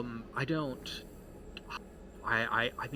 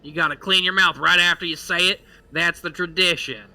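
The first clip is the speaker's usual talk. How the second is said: high, loud, insistent delivery; old townsman laying down tradition; 7 semitones higher, 10 dB louder than usual. Acted. Believer explaining the spitting rule, loud and insistent. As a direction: lecture them loudly as a stubborn believer.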